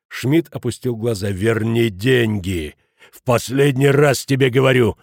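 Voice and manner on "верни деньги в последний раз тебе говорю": slow demand growing louder; menacing ultimatum. A narrator's reading. Schmidt warning Futaki a last time, menacing. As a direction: draw out the demand, then hammer the warning.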